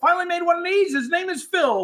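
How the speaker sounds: high, excited introduction; excited announcement. Acted. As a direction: announce him like a host. Announcing a new guest.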